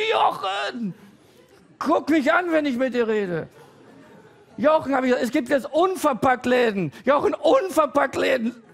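normal talking voice

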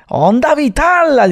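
high, long-drawn attack call; Spain dub attack cry. Retold or imitated. Quoting the Spanish Dragon Ball dub, drawn-out attack cry. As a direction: draw out the anime attack name, high. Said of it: "cuando mirabas Dragon Ball".

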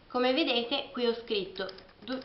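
ordinary talk, nothing marked

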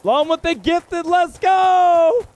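slow build to an excited shout; rising hype into a loud cheer; hyped. Real, not acted. Hyped for a super chat.

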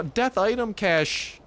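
slow, high, stunned repeat; disbelieving. Real, not acted. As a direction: drag it out in disbelief.

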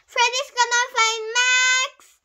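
high, loud, drawn-out holler; yelling announcement. A narrator's reading. Classmate spreading fight news, yelling announcement. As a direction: holler the news across the yard. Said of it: "yelled Jessie".

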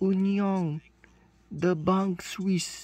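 very slow, showy French reading of Union de Banque Suisse; French accent. Shown as a demonstration. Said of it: "ออกเสียงเป็นฝรั่งเศส".

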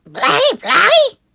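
very high, loud babbling question; baby voice. Acted. The baby on the plane asking about the bunny, squeaky babble. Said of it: "asked the baby".

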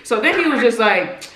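ordinary delivery

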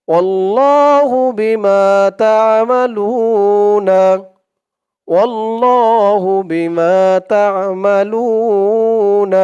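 slow melodic tajweed chant with drawn-out vowels; Quran recitation. Acted. Teacher reciting the verse for students, slow and melodic. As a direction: stretch each vowel like a reciter.

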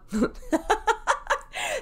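high giggle; high laugh. Real, not acted. Laughs at her own pitch.

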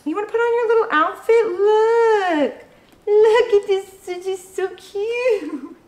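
high, excited cooing at a dog; squealy, doting pet-owner voice. Real, not acted.

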